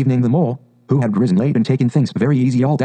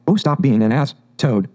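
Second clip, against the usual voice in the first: ordinary delivery, nothing marked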